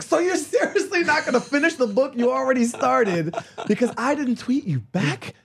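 high-pitched, exasperated mock disbelief; incredulous retort. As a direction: push the whole protest up high, aghast. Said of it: "I was like".

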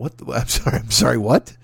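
confused laughing disbelief; mock double take; bewildered.

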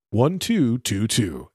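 each two drawn out, slow; slow imitation of Siri reading digits; slow phone-number read. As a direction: read the twos slowly like Siri. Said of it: "said each one differently".

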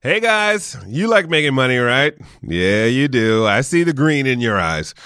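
loud upbeat ad read. Acted. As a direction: sell it like a radio spot. Reads an Uber ad.

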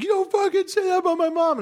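put-on high, furious kid's outburst; upset kid snapping back, voice jumped high; angry kid. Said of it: "He's like".

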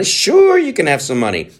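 squeaky mock banker. Bank answering, squeaky high.

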